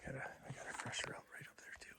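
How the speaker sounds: hushed whisper to a hunting partner; wildlife watching whisper. Real, not acted. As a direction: whisper so the deer can't hear. Hunters whisper near a deer.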